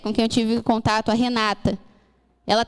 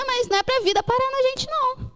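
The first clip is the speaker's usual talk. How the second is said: high exclaimed answer; 12 semitones higher than usual. Real, not acted. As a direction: leap up high on "É a igreja". Preacher stressing point to congregation.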